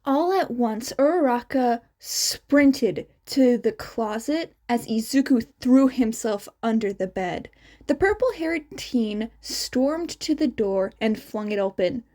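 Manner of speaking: ordinary delivery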